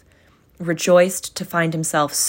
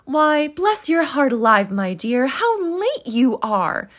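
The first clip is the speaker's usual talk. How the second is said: motherly welcome; 8 semitones higher than usual. Acted. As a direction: welcome her daughter home. Mother greets daughter.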